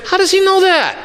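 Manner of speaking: high-pitched mock amazement; astonished. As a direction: Saul stunned, voice jumps up. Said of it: "Saul had to wonder".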